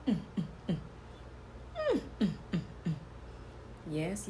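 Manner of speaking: sassy whispered diva hype; sassy drag queen. Acted. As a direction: hype the look in a hush. Sassy posing banter to camera.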